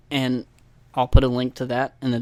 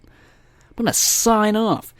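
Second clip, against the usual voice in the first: lightly hammed radio host, higher and slow; mock radio sign-off; 8 semitones higher, slower than usual. Podcaster mocking his own sign-off, mock radio host. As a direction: stretch it like a DJ signing off.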